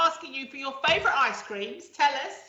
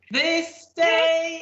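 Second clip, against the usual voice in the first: louder, higher jingle call; announcer voice; 4 semitones higher, 6 dB louder than usual. Acted. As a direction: announce the segment title. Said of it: "It is time for".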